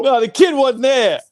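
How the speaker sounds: high, loud mocking echo; mock protest. Mockingly echoing bandmate's protest.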